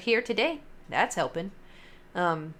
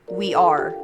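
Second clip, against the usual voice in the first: louder ghostly EVP reply; imitating ghost voice recording; 5 dB louder than usual. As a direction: echo the voice on the tape. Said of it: "you hear clear as day".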